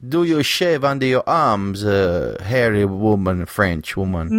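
teasing put-on French accent. Co-host teases host as a French woman, French accent. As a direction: quiz them in hammy French English. Mock French banter between hosts.

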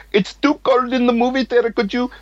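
very high put-on Indian accent; imitated Indian accent. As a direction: make a polite request in a high mock Indian accent. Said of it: "an Indian person would come out and be like".

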